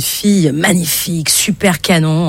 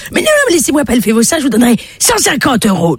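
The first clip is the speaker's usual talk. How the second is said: fast, high insistent begging; urgent wheedling; pleading old man; 7 semitones higher, faster than usual. Acted. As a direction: rush in with a higher offer. Old man insists, raises offer.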